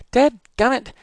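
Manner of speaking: high, loud exasperated yell. Acted. Boy yelling at the hens in disgust. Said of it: "I yelled out loud".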